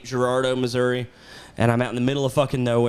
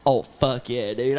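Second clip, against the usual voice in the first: ordinary talk, nothing marked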